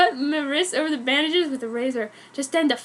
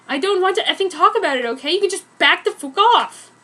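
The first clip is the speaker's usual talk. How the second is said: fast, louder, higher retort; fast angry retort; 6 semitones higher, 6 dB louder than usual. Acted. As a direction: push him away with words.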